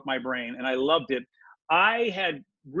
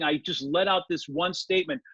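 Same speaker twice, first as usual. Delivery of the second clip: normal talking voice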